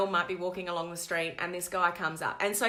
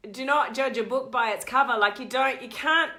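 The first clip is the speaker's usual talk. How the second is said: ordinary talk, nothing marked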